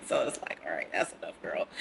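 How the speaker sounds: low whispered self-talk. Retold or imitated. Telling herself to stop.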